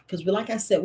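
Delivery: ordinary delivery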